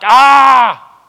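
loud, high exasperated "ah" yell; frustrated yell. Retold or imitated. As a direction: let out a fed-up cry. Stomping off in frustration.

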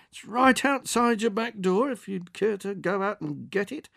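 professional good cheer. Acted. Delivery man coaxing the old woman, with professional good cheer. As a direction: keep it chirpy and patient.

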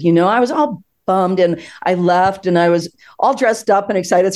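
ordinary talk

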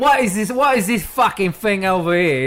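indignant British bloke, building; mock British accent. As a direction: complain in hammed-up British. Riffing as angry British bakers.

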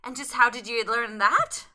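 put-on Irish accent. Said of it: "trigger sentence before each time".

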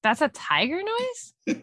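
teasing a friend about a feeble tiger noise, incredulous. Real, not acted. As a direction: stretch it out in mock disbelief. Mocking friend's weak tiger noise.

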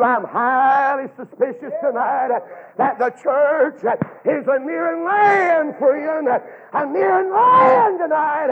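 rising from talk to a high, loud shout; preaching build. Real, not acted. Preacher climbing to a shout over the church.

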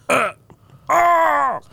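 high strangled grunt into a cry; monster death grunt. Retold or imitated. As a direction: mimic a game monster getting hit. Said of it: "they had the same sound for when they".

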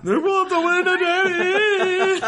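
put-on high tearful little kid voice; whiny crying child. Kid complaining to Daddy after the foghorn, high whiny child voice. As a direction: whine it like a startled child.

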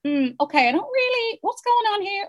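high-pitched, lightly mocked puzzlement; hesitant high query, put on; imitated doubtful reader. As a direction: voice a doubtful critic, pitched well up. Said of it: "when people say".

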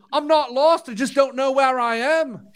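high, light imitation of a Liverpool driver; Liverpool accent. Telling the hosts what the bus driver said, in a Liverpool accent. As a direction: mimic the old driver's Liverpool lilt, a bit hammed-up. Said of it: "his Liverpool accent".

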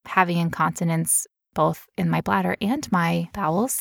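ordinary delivery

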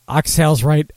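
ordinary talk, nothing marked

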